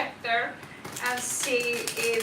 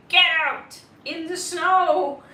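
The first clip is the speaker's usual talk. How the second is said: furious yell, much louder and higher; angry shout; 5 semitones higher, 10 dB louder than usual. Retold or imitated. Owner yelling at the cat that pooped inside, angry shout. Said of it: "so I got really angry".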